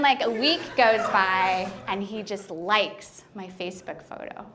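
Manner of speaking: normal talking voice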